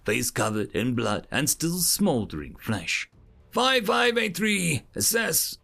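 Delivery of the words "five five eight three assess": instructor command. Acted. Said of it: "educator addresses cadet".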